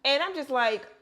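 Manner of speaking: ordinary talk, nothing marked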